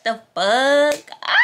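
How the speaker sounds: sudden loud startled cry. Real, not acted. Vlogger startled mid-message to friend. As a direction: jump at a shock.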